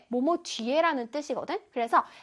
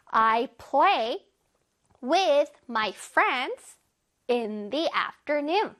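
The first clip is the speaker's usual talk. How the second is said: very slow, phrase by phrase; slow model reading; much slower than usual. Shown as a demonstration. Teacher leads pupils through a long sentence, very slowly. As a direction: spell out each phrase with gaps. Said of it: "우리 천천히 해보자".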